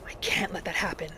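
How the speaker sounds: hushed whispered resolve. Acted. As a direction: say it under your breath, determined. Whispered resolve after vow.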